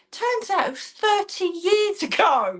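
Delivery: high, slow, drawn-out disbelief; stretched-out, high-pitched amazement; incredulous reveal. Real, not acted. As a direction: land the punchline with astonishment. Punchline of neighbour story.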